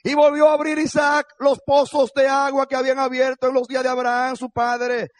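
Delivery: high, loud declaimed verse; preacher's ringing proclamation from the Bible; full-force scripture reading. Real, not acted. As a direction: cry the verse out over the congregation.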